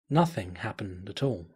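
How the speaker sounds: ordinary delivery, nothing marked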